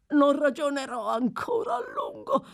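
put-on high-pitched desperate old man; frantic despair. A narrator's reading. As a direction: answer in fury and terror. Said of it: "la furia e il terrore".

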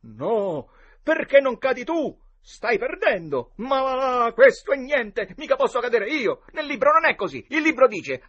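high, loud, indignant protest; boyish quarrel. A narrator's reading. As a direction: argue back at full voice. Boys quarrel over who falls. (protesta infantile a gran voce)

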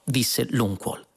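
ordinary delivery, nothing marked

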